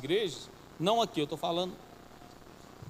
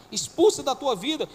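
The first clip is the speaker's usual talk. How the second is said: louder, higher command; commanding cry; 6 semitones higher, 5 dB louder than usual. Acted. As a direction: cast it out with force. (ordem gritada: Expulsa da tua vida)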